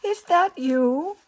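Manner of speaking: loud, high, drawn-out question; calling out. A narrator's reading. Old lady inside asks who is there, calling out. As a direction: call out to someone unseen. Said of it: "called a voice inside".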